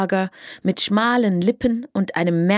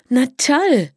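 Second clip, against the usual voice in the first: louder, higher delighted exclamation; enthusiastic; 5 semitones higher, 5 dB louder than usual. A narrator's reading. Melanie welcomes the headquarters idea, enthusiastic. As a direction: burst out with delight. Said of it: "sagte Melanie begeistert".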